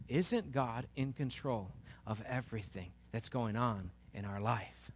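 ordinary talk

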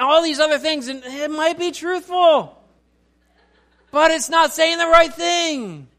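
high, loud sermon delivery; forceful preaching. Real, not acted.

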